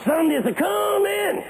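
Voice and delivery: high, long-held proclamation; triumphant preaching shout. Retold or imitated. Old pastor proclaims hope to congregation, triumphant shout. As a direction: sing it out high and slow to the pews. Imitated sermon climax line.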